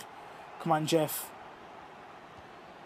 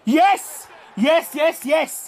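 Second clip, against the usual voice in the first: joyful shout; 7 semitones higher, 5 dB louder, much slower than usual. Real, not acted. Penalty save celebration.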